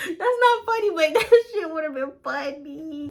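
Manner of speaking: high-pitched, helpless laughing through words; real laughter. Real, not acted. Storyteller amused by imagined bottle hit, laughing. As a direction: try to scold yourself while cracking up.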